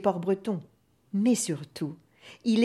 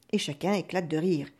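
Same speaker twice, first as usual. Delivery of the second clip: usual speaking voice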